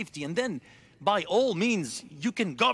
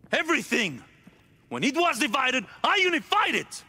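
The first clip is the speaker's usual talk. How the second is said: louder and higher, building to a shout; rising shout; 8 semitones higher, 4 dB louder than usual. Acted. Ruler shouting his record at the captive. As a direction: roar out his achievement.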